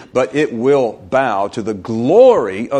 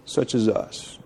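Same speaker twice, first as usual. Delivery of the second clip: ordinary delivery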